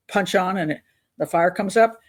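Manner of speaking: usual speaking voice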